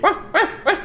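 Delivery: high-pitched whimpering pup noises; puppy whine impression. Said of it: "that's how he sounded".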